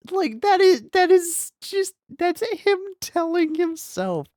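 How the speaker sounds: high, loud and slow wail; anguished wail. Real, not acted. Streamer wailing slowly about a guilt scene. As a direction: stretch it out in pained disbelief.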